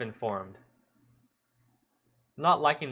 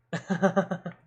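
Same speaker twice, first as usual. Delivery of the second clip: higher, staccato fake chuckle; forced laugh; 7 semitones higher, 4 dB louder than usual. Policeman laughs alone before the girls, forced laugh. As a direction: laugh with no real mirth. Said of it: "the way he laughed didn't make me feel better".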